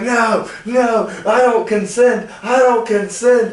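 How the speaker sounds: very high, loud imitated protest; mock screaming. Wrestler protesting a prank to his friends, imitated screaming. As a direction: shriek it in playful mock outrage. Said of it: "and he starts screaming".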